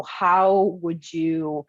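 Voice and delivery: ordinary delivery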